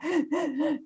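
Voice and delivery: high, hitching gasps for breath; imitated sobbing gasps. New mom unable to breathe on the phone, acted sobbing gasps. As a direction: mimic catching your breath mid-sob. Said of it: "kind of sobbing".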